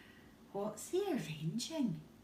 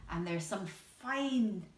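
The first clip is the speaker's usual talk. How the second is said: ordinary delivery, nothing marked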